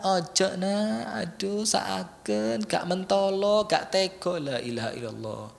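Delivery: soft, slow, whiny worried mum; fretting mother. Retold or imitated. Preacher mimics a doting mother refusing to part with her child, soft and whiny. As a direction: sigh it out like a mother who can't bear to let go. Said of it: "Ibu zaman sekarang".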